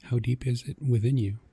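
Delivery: normal talking voice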